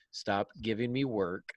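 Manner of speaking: ordinary talk, nothing marked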